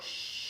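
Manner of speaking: soft, long librarian shh. Shown as a demonstration. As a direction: hush the room with a long breathy shh. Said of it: "breathe out on sh".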